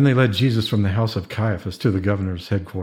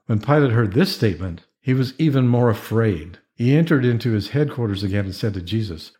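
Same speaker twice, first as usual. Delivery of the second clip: ordinary delivery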